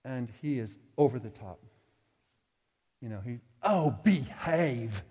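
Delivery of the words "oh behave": slow, drawn-out English "Oh, behave"; slow mock British "behave"; British accent. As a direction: impersonate an Englishman, stretched out slow. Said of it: "He's from England. He talks that way".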